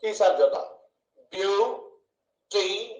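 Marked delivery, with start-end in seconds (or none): 1.3-2.9 బ్యూటిఫుల్ slowly split as "బ్యూ... టి... ఫుల్"; long pauses between బ్యూ, టి and ఫుల్; three syllables. Shown as a demonstration. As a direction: break బ్యూటిఫుల్ into three slow beats. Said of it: "ఎన్ని ముక్కలు కొట్టాం మనం గమనించండి".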